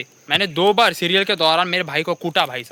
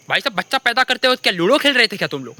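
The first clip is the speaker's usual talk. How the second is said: usual speaking voice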